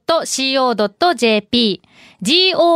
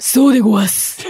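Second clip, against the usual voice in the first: lightly voiced sumo wrestler; a touch of sumo-wrestler bluster; mock sumo wrestler; 3 semitones lower than usual. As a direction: play along with a hint of sumo-wrestler voice. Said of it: "お相撲さん。".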